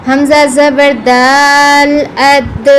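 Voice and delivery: echoing d at the end; with qalqala. Shown as a demonstration. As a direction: release the د in اد with a bounce, slowly. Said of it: "قلقلہ".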